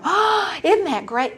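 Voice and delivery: high, gasping delight, acted out; delighted gushing. Wife reacting to husband's meeting news, gushing. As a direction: gush as if thrilled for them.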